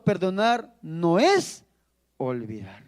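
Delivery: slow, deliberate, dropping to a hush on olvidar; slow preacher's emphasis; slow emphatic. Acted. Preacher drives home key teaching.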